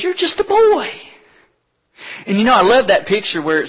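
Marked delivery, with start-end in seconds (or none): 0.0-0.9 high, loud scoffing king; mock-dismissive King Saul. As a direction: wave the boy away. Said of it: "Saul says".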